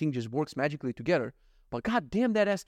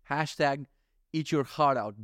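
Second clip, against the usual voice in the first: ordinary delivery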